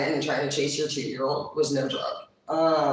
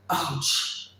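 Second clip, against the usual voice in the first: ordinary talk, nothing marked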